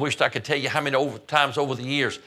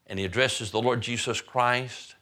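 ordinary delivery

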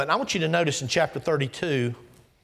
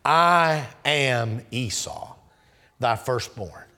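very slow, each word weighed; drawn-out solemn declaration; slow spoken together; 6 semitones lower, much slower than usual. Shown as a demonstration. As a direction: lay the three words out slowly. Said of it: "Can we say those three words together?".

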